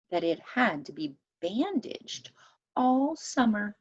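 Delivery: usual speaking voice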